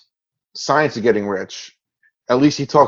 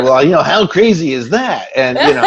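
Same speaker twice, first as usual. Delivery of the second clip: James Ray impression; 6 semitones higher, 3 dB louder than usual. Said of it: "You're doing great impressions of these guys".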